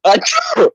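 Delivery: high squeaky put-on sneeze; fake sneeze. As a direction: sneeze on cue, playfully. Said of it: "It's your turn".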